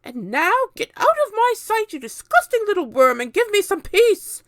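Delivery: very high, loud scornful yell; shooing away. A narrator's reading. Aunt Spiker driving James away, high and loud. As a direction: drive the boy off with contempt. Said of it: "Aunt Spiker".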